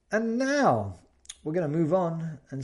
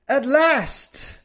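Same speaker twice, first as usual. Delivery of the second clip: relieved at last; 10 semitones higher than usual. Shown as a demonstration. Example of relief, sun out.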